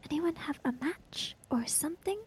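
whispered question in the dark; whispering. Acted. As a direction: keep it to a breath so no one hears.

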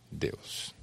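breathy whispered word. Real, not acted. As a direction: drop to a whisper on the name. Hushed climax word in sermon.

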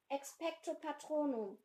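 Harry crying the spell over the noise; called out. Acted. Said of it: "rief Harry".

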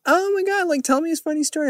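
high, fast squealing fan voice; mock gushing fan. Host mocks people begging for the rat story, high gushing squeal. Mocking people asking for stories.

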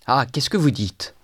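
ordinary delivery

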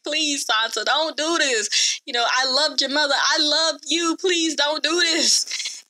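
high-pitched, dragged-out fake-crying plea; mock tearful begging. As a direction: imitate a man whining and begging for his life. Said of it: "he starts to beg and he starts to cry".

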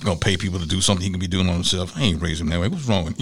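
father grumbling about his son paying others; mumbling to himself. Retold or imitated. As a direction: grumble under your breath as dad. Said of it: "he's just mumbling to himself".

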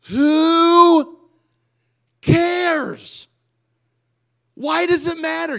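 high, booming, drawn-out cry; exasperated outburst. Acted. Preacher exploding over a child's mere mistake, exasperated shout. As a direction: belt each word out long and loud.

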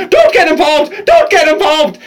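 imitated high-pitched urgent plea; mock frantic warning, each one punched out; panicking dad. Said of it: "He's going".

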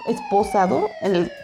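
high, slow gasp of disbelief; shocked disbelief. Real, not acted. Describing the handcuffed boy, aghast and high.

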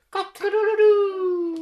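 high loud rooster crow; crowing proud rooster. Acted. Reviewing rooster sound.

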